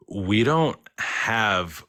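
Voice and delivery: very slow, weighted words for suspense; deliberate disclosure. Retold or imitated. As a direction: stretch each word out carefully. Employee breaks uncanny news, retold.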